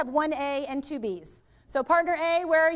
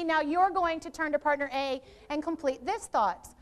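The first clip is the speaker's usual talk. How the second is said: normal talking voice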